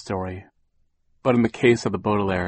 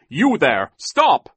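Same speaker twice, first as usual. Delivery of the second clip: loud command rising to a high yell; calling out; 8 semitones higher, 8 dB louder, much slower than usual. A narrator's reading. Mister Poe hails fleeing villains, calling out. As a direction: hail a fugitive, then yell.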